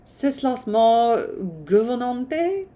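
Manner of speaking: put-on slow, careful French with an English accent; struggling through a French line; halting French. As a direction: sound out the French line slowly. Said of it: "French is hard".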